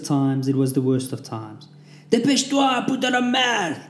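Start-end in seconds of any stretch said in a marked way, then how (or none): 2.1-3.8 high yelled French command; shouted order. A narrator's reading. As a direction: bark it like a drill sergeant. Drill instructor yelling orders.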